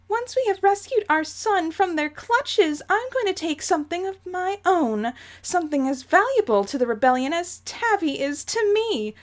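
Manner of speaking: high, imperious matriarch plotting revenge; theatrical high-voiced resolve; Jane voice. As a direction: declare it in a prim, high-pitched villainess voice. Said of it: "my Jane voice is slowly turning into Dolores Umbridge".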